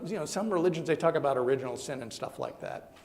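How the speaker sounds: usual speaking voice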